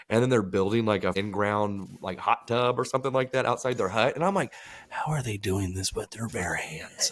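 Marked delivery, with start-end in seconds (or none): 4.9-7.1 hushed, slow whisper of disbelief; whispering at the screen in amazement; amazed whisper. Retold or imitated. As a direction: breathe it out in awe, slowly. Amazed viewer quoting own thoughts.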